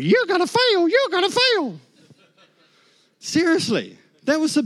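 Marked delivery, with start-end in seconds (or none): 0.0-1.8 high, loud taunting inner voice; the doubting flesh jeers a prediction of failure. Retold or imitated. Preacher voices his flesh taunting him, high and loud. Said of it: "the flesh is going".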